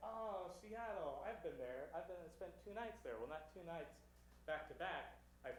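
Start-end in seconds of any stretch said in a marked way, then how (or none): 0.1-3.2 mock impressed date. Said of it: "she's like".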